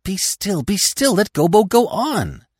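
high, urgent hushing cry; impatient high-pitched outcry. A narrator's reading. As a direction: cry out to silence the interrupter. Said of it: "the others cried out at once".

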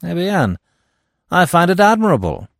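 high, loud, assured declaration; imperturbable. A narrator's reading. Giraud contradicting the magistrate, imperturbable. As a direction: declare it with untroubled certainty. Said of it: "said the other imperturbably".

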